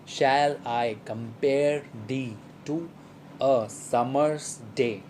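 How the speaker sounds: slow, measured "Shall I com-PARE thee to a SUM-mer's DAY", beat by beat; deliberate, stretched-out scansion of the verse; iambic stress pattern. Shown as a demonstration. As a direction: spell out the iambs syllable by syllable, long on every second one. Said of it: "a short syllable followed by a long syllable".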